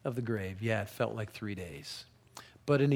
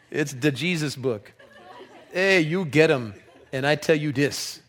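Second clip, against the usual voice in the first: louder, lively pidgin read with laughs; 6 dB louder than usual. Acted. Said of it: "one of my all-time favorite translations".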